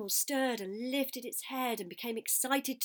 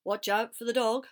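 raised, louder sheep call; loud farmyard alert; cried warning; 2 semitones higher, 8 dB louder than usual. A narrator's reading. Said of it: "cried the sheep".

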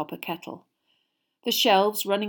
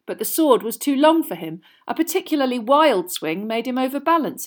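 ordinary delivery

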